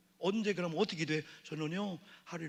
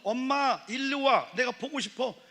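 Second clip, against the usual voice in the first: put-on higher, louder childlike call; lightly voiced child calling; child calling mom; 8 semitones higher, 7 dB louder than usual. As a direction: call for mommy like a toddler.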